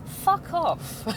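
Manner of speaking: high-pitched scoffing "fuck off"; mock dismissive retort. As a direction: toss it off with a scoff. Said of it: "And I'm going".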